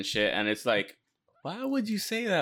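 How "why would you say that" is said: high-pitched, incredulous retelling; mock indignation. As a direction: scold the character in mock disbelief. Mock-scolds a TV character.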